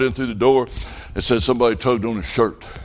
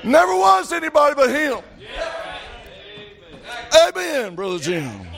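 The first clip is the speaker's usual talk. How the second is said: preaching at full force; 14 semitones higher than usual. Acted. Preacher shouting the lesson to his church, full force. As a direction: drive it home with shouted fervor.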